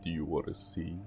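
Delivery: lightly voiced century-old demon, boastful; a hint of a demon bragging to a child; a lightly voiced demon boasting to the child about stealing souls; demon monster. Monster offers to show powers.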